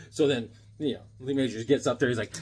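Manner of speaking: normal talking voice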